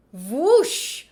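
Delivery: a rising, airy whoosh of a lightsaber swing. Shown as a demonstration. Reading a kids' Star Wars book, she voices a lightsaber whoosh. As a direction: swing the saber with your voice. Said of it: "that does get a good lightsaber sound".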